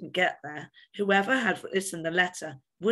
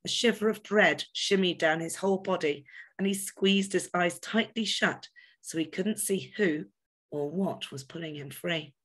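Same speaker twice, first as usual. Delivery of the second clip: plain reading voice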